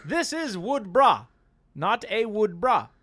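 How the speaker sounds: high loud put-on English accent; mock posh English. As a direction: lecture him grandly with mock English manners. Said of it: "He's like".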